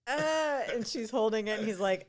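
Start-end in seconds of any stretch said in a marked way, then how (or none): 0.1-0.6 high, hesitant mock fumble; high startled stammer; startled high quote. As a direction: fumble it out, voice jumping up.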